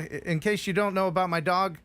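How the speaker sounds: ordinary talk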